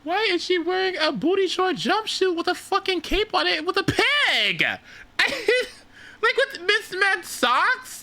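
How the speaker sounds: high, squeaky disbelief; incredulous high. Real, not acted. Streamer mocking the jumpsuit with cape, squeaky disbelief. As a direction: voice climbs in amused outrage.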